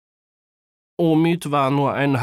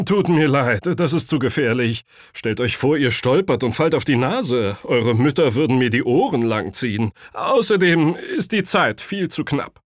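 lightly voiced, louder tailor turning children down; a worried adult saying no, firmly voiced; apologetic tailor father; 3 semitones higher, 5 dB louder than usual. A narrator's reading. Voices Herr Aslan refusing the children. (bedauernd ablehnender Schneider)

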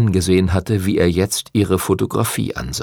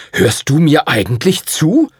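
impatient, higher-pitched demand; exasperated question; losing patience; 6 semitones higher, 3 dB louder than usual. A narrator's reading. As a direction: snap at someone who won't listen. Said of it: "Der Principe verlor die Geduld".

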